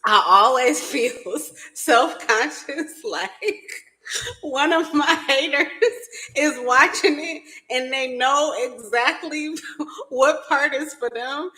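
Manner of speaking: words squeezed out through laughter; helpless giggly talk; laughing hard. Real, not acted. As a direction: try to explain while cracking up. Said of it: "that I'm laughing so hard".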